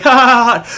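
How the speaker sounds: high, loud mock laughter; loud ha-ha-ha mimicking the kid; imitated taunting laugh. Kid popping his head round the corner, imitated taunting laugh.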